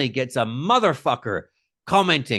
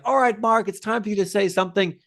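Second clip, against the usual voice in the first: higher, imitating his own upbeat cue; raised-pitch presenter prompt; mock host handover; 8 semitones higher than usual. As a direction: toss it brightly to the co-host, in imitation. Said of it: "I always jump over to you".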